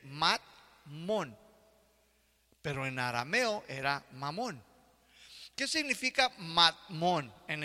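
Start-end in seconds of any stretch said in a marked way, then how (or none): 0.0-1.4 matmon repeated, crisp T between mat and mon; Hebrew pronunciation. Shown as a demonstration. Preacher repeating the Hebrew word, crisp T. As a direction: say matmon again, landing the T. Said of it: "con T".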